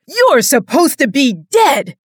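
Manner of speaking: loud, high raging yell; high furious shout; furious screech. A narrator's reading. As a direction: scream it down into the grave. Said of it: "the hunter screeched".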